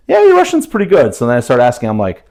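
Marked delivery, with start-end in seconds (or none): 0.1-1.1 much higher, louder assistant voice; put-on high, approving chatbot; ChatGPT. Speaker quotes the AI praising his Russian, high put-on chatbot voice. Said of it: "Chat G P T is telling me".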